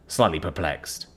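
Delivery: ordinary delivery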